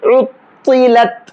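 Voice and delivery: उत्तिलत slow, heavy तौ then thin ता; drawn out उत्तिलत with contrasted t sounds; heavy ta, light final t. Shown as a demonstration. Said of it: "कैसे?".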